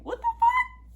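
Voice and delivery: high, loud shriek of disbelief; outraged outburst. Real, not acted. Reacting to a half-hearted love confession, outraged shriek.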